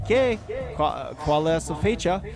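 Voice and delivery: high, loud broken Spanish; hammed-up school Spanish; mock Spanish. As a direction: fake ninth grade Spanish. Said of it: "I'm like".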